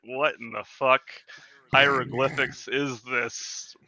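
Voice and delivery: retold bewilderment, slowly spelled out then breaking into laughter; mock bafflement. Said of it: "I'm like".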